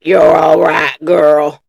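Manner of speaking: low, loud cowboy drawl; a lightly done gruff outlaw; Arthur Morgan impression. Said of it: "That was my Arthur Morgan".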